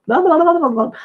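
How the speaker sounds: high, loud rapid blah-blah babble; mock chatter. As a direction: rattle it off like a chatterbox. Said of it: "I started chattering, chattering".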